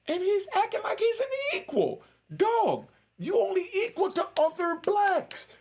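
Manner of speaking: very high-pitched, incredulous tirade; heated rant. Real, not acted. Heated rant about acting equal.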